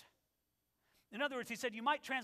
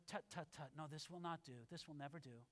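dismissive tut-tutting; 7 semitones lower, 13 dB quieter, faster than usual. Retold or imitated. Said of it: "And he goes".

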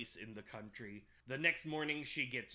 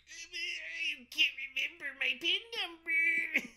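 high, slow, laughing fake cry; hammed-up weepy confession; mock sobbing; 14 semitones higher, 7 dB louder, slower than usual. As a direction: wail it like a hammed-up sob. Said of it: "And she's like".

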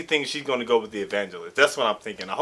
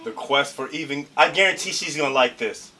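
usual speaking voice

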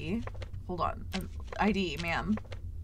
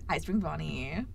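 normal talking voice

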